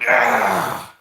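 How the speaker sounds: loud groaning stretch cry. Real, not acted. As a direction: let out a big stretching groan. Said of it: "had to stretch".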